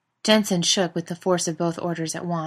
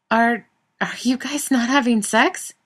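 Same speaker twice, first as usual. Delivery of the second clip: high, slow stunned question; shocked; 7 semitones higher, 6 dB louder than usual. A narrator's reading. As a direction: sound truly taken aback. Daniil shocked on phone.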